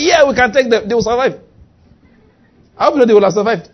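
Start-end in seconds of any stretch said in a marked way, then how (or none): 0.1-1.4 higher, keen crowd echo; bold, raised-pitch rallying agreement; eager agreement. Acted. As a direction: act out confident followers chiming in. Crowd agrees boldly with Caleb.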